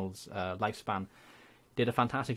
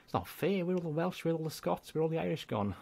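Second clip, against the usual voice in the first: higher-pitched mock complaint; mock whine; 7 semitones higher than usual. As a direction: play hard done by, jokingly. Mock complaint about all-English list.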